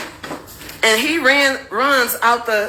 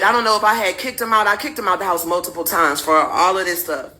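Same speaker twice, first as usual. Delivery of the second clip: ordinary delivery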